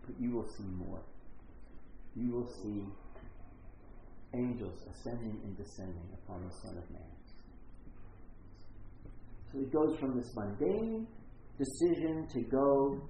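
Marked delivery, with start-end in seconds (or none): 0.1-7.1 softer, lower, knowing reply; calm the excited man down; quiet, lower, patient promise; gently amused. Retold or imitated. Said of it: "And Jesus kinda goes".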